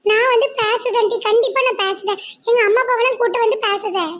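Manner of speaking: put-on high, earnest girl voice; young woman friend. College friend promising to talk to the parents, high earnest voice. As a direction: promise firmly to step in.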